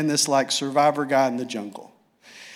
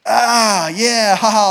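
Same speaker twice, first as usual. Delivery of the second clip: higher, louder villain cackle; gloating laugh; 5 semitones higher, 6 dB louder, slower than usual. Retold or imitated. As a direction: crow over the stolen gem. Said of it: "and he's laughing".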